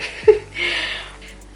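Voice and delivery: loud breathy laugh; bursting laugh. Real, not acted. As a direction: laugh out at the mishap. Laughs at spoiled presentation.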